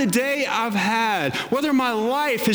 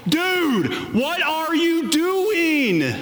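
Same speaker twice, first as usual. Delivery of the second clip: mock yell out, pitched up high; exasperated yell; 7 semitones higher, much slower than usual. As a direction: call out to the foolish hero in disbelief. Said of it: "want to yell out".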